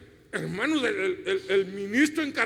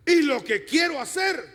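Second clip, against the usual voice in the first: emphatic preaching shout; 6 semitones higher, 4 dB louder than usual. Retold or imitated. Preacher voicing Pablo's struggle.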